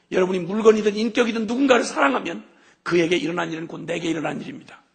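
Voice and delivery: loud, high, impassioned sermon voice; a pastor driving home God's love to the congregation; full-force preaching. Acted. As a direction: preach it out with fire.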